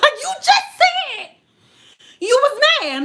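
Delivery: high, loud, drawn-out accusation; exasperated mock yell. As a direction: squeal it in exaggerated disbelief. Acted quarrel with a deflecting Scorpio.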